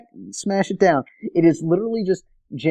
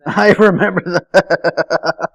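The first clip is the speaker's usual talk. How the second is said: laughing; 3 semitones higher, 7 dB louder, much slower than usual. Real, not acted. Co-host laughing at the recap.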